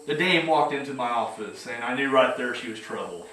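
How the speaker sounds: low hard-boiled narrator, lightly done; gruff private eye voiceover; noir detective. Retold or imitated. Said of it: "You know, that kind of talk".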